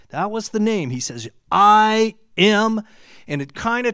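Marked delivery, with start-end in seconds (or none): 1.5-2.8 loud, sudden proclamation; booming I am. Acted. Preacher voicing God's reply to Moses, booming. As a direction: boom it out like God's voice.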